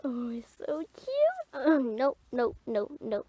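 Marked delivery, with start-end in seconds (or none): 0.0-1.1 put-on soft whispered swoon over a cute boy; whispered gush. Girl secretly gushing to herself about a boy, whispered. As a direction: breathe it out to yourself, no voice.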